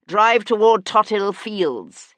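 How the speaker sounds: slow, loud call up to the coachman; shouting. A narrator's reading. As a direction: bark it slowly so the coachman hears. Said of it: "he shouted to the coachman".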